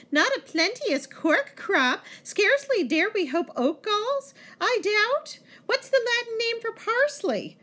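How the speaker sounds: very high, mimicking table talk; mocking monk's voice. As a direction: mimic the monk in a high mock voice.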